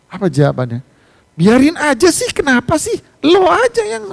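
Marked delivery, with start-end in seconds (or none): 1.3-4.0 put-on high, loud, snippy teen comeback; mock sassy teenage girl; sassy schoolgirl retort. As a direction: mimic a bratty girl talking back. Teen girl retorts when scolded.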